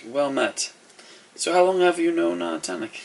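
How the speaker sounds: high-pitched courteous character voice; courteous host. Acted. Host questions the newcomer about a friend, high courteous voice. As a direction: ask politely in a lifted voice. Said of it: "He goes".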